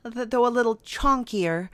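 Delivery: ordinary talk, nothing marked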